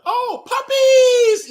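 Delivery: high, slow, shouted leer; drawn-out delighted shout; excited shout. Retold or imitated. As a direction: yell it like a thrilled horny fan. Excited leering at lingerie match.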